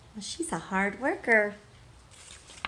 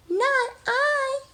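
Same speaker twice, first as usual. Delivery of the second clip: tiny mouse squeak, much higher and louder; squeaky mouse; 4 dB louder than usual. Acted. Mouse's refusal in the story.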